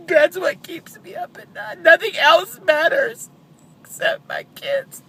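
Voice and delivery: sobbing, high and strained; snotty crying. Real, not acted. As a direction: voice cracking with grief. Recalling finding her son.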